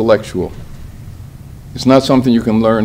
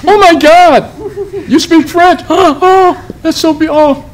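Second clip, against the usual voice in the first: hammed-up, very high-pitched swooning over a French speaker; mock squeal of delighted disbelief; gushing amazed American; 4 dB louder than usual. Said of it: "That's our favorite expression".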